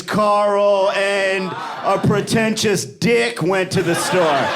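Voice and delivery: slow, pointed, smug correction. Retold or imitated. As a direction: correct the friend with exaggerated superiority. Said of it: "what you meant to say".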